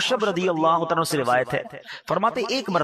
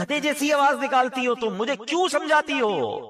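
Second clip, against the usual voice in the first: mocking son; 9 semitones higher than usual. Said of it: "ماں کو کہتا تھا".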